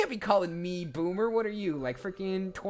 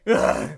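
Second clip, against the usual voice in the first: tired groan; 4 semitones higher, 10 dB louder than usual. Shown as a demonstration. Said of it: "I am Steve".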